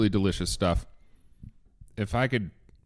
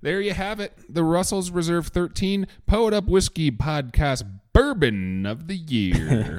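higher, slow, announcer proclamation; mock announcer; 8 semitones higher, 4 dB louder than usual. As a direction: crown the winner like a gala host.

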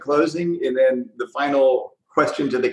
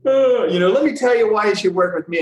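higher-pitched recruiter sales pitch; sales spiel; 8 semitones higher than usual. Retold or imitated. Recruiter pitching a company owner who called, confident spiel. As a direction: launch into an eager pitch, voice up high. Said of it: "I went into my spiel".

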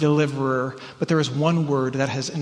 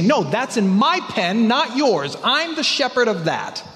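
indignant refusal; 8 semitones higher, 4 dB louder, slower than usual. Retold or imitated. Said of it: "They say".

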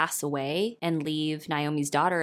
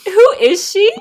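amazed; 12 semitones higher, 16 dB louder, slower than usual. Pretend. Hyping friend, mock amazed.

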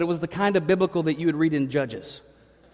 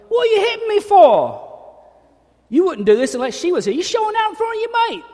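much higher, louder outraged protest; high indignant husband; 7 dB louder than usual. Retold or imitated. Husband hit by his wife, squealing much higher and louder. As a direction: squeal like a man just slapped. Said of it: "hit the husband".

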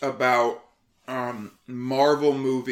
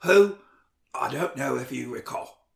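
Michael Caine. Acted. Host telling listeners about young Peter Parker, Michael Caine impression. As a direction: recite solemnly in a put-on British voice, with pauses. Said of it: "that's a pretty good Michael Caine".

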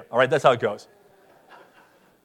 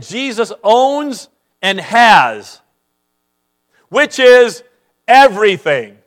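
building emphasis; 3 semitones higher, 6 dB louder, slower than usual. Acted. Preacher builds to a climax.

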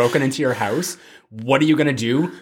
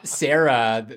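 ordinary delivery

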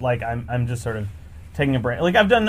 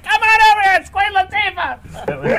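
high, loud mom calling over; excited mom; 6 dB louder than usual. Retold or imitated. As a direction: call your son over to meet a star.

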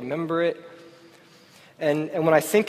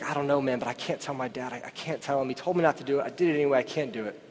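put-on much softer, a boy afraid to tell his dad; hushed scared kid; 3 semitones lower, 8 dB quieter, faster than usual. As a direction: murmur it like a frightened kid hiding something. Scared kid confiding secret.

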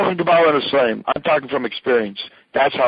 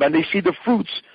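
normal talking voice

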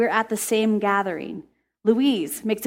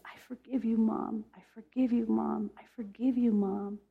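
much softer, hushed "I forgive you, Mom"; soft forgiving; 10 dB quieter than usual. Retold or imitated. Young woman forgiving her mother, hushed and soft. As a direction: murmur it gently, again and again.

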